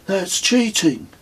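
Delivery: high, slow, indignant protest; mock-outraged, drawing out the complaint. A narrator's reading. Kez objecting to pilgrims' rucksacks carried by truck, indignant. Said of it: "said Kez indignantly".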